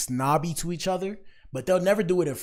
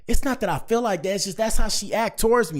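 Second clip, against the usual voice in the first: defensive woman; 8 semitones higher, 6 dB louder than usual. Retold or imitated. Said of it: "And they be like".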